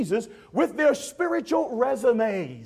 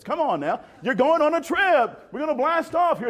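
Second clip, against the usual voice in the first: ordinary talk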